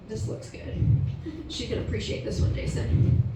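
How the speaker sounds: unvoiced hushed aside; whispering. Real, not acted. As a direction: whisper it to him privately. Aside while browsing his phone.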